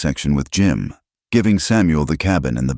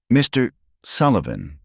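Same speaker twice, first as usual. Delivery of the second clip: mildly formal, higher-pitched address; 8 semitones higher than usual. A narrator's reading. May addressing her father at the saloon, formally. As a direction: greet him formally, with measured distance. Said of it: "she said formally".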